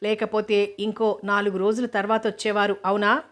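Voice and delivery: usual speaking voice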